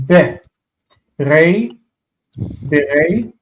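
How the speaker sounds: "Be", "Re", then joined as "Bere", with long pauses; slow syllable reading. Shown as a demonstration. Teacher helping students process the letters, slow separated syllables. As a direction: sound out "Bere" piece by piece. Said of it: "I just want to read slowly".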